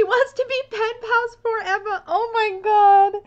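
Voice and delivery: high, teary squeal, nearly crying with joy; overwhelmed squeal. Real, not acted. Gushing to viewers over the camp-friend card, high teary squeal. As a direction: let it crack into a happy near-sob. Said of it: "I'm gonna cry".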